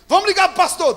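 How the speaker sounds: high, loud panicked cry; panicked shout. Retold or imitated. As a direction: call out in alarm. Panicked boys calling for pastor.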